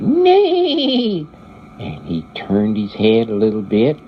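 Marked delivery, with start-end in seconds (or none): 0.0-1.3 a high, ringing whinny, much higher than the narrating voice; horse neigh. Retold or imitated. Flying horse neighs as the girl climbs on, high whinny. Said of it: "the flying horse went".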